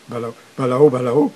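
BA, LA, O run together as one word, repeated; whole-word reading. Shown as a demonstration. Teacher showing global reading to learners, syllables blended. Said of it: "la lecture globale".

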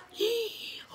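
shocked gasp. Retold or imitated. Shocked at the bone.

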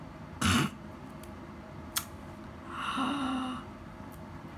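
long horrified gasp. Real, not acted. Vlogger after the wax rip, gasping in horror. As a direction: suck the air in, shocked.

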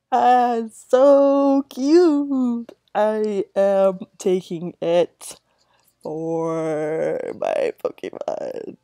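loud, slow, drawn-out gush; gushing over cute. Real, not acted. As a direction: swoon over the cute little creature, stretching every word.

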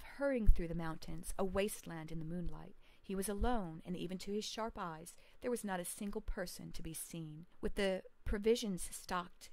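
usual speaking voice

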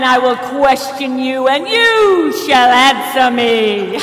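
booming, drawn-out shout, hammed-up wrath of God; screaming God. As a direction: roar a challenge at a puny mortal. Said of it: "screaming at Job".

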